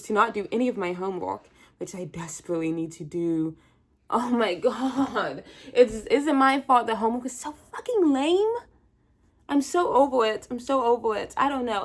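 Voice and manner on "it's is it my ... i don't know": louder and higher, fed up; loud fed-up whine; exasperated rant. Real, not acted. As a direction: vent like you've had it with homework. Ranting about homework.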